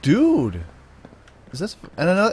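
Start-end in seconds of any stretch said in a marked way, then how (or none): 0.0-0.6 loud, higher cry of amazement; excited exclamation. Real, not acted. Gamer finding power armor, real excited exclamation. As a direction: burst out at a great find.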